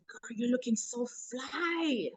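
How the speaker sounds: high, soft gushing compliments; delighted praise. Retold or imitated. Boss praising transformed coworker, soft high gushing. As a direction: gush over how fly she looks. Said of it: "I went to her and I said".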